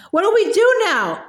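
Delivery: ordinary delivery, nothing marked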